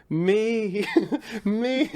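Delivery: high, loud, drawn-out self-centred "me"; Julia Roberts. Acted. Quoting a Family Guy charity scene, high hammed-up diva. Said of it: "and she's like".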